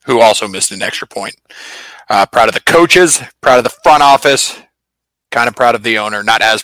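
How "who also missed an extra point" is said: ordinary talk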